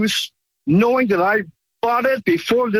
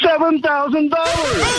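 high, drawn-out excited cry; thrilled, much higher and slower; excited announcement; 8 semitones higher, slower than usual. Real, not acted. Caller announces free silver windfall.

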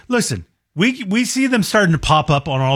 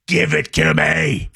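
drawn-out craving; 9 semitones lower, slower than usual. Real, not acted.

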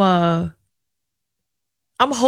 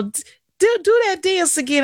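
high imitation of dad; 10 semitones higher than usual. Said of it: "And he's like".

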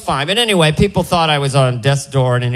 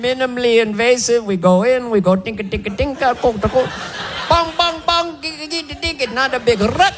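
put-on high Indian accent, cheerfully making surgery noises; gleeful Indian doctor; 7 semitones higher than usual. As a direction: sell the bypass like a fun game, Indian accent. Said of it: "describes bypass surgery like it's fun".

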